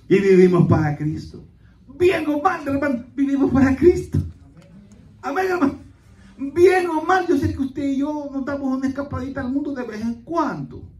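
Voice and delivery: high, loud exhortation; full-force preaching. Real, not acted. Pastor tells brethren we live for Christ, preaching at full force. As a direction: cry it out to the whole church.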